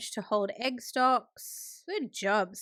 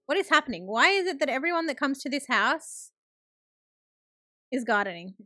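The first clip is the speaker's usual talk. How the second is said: baffled exasperation; 3 semitones higher than usual. Real, not acted. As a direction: ask in playful disbelief. Mock disbelief at gardening guests.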